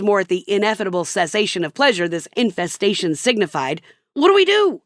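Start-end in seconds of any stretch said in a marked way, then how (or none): 4.1-4.8 high whiny husband voice; panicky husband. Retold or imitated. Husband asks wife what to do, high and whiny. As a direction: squeak it out helplessly.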